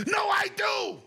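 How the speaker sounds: high falsetto yell; hammed-up, squealed denial; mock screaming protest. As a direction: imitate a frantic gamer insisting. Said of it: "He's screaming".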